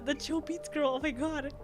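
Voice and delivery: ordinary delivery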